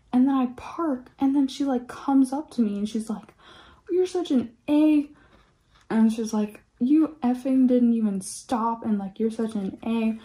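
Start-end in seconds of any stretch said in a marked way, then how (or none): 3.9-5.1 high, loud mock insult; loud, higher put-down from a driver; imitating an angry lady. Angry stranger in retold story. 6.8-10.2 louder, higher retold scolding; raised, accusing mock yell; mock angry rant. Angry stranger in retold story.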